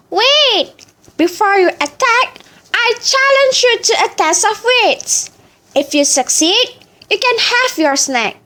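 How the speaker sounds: put-on high, proud old chicken; wise chicken voice. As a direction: boast like a wise old bird. Chicken challenges tiger.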